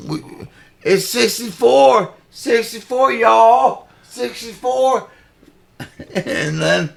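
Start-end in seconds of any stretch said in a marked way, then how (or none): 0.1-5.1 high, loud mock southern rally shout; hammed-up southern politician, higher and louder; mock Kamala southern drawl. Mocking Kamala's Detroit rally in a put-on southern voice. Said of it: "she's like".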